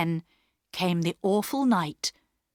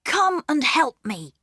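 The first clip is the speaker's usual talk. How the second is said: put-on higher boy's voice, urging; Peter voiced; 9 semitones higher, 4 dB louder than usual. A narrator's reading. Peter asking his sisters for help, boyish and higher. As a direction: call the girls to come along.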